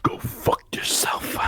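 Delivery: voiceless, forceful rasp of defiance; hushed defiant retort. Retold or imitated. Cody refusing The Rock, fierce whispered retort.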